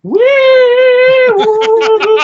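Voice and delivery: high loud wee woo siren; patient imitating a fire truck siren; siren noise. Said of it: "just stares at her and just goes".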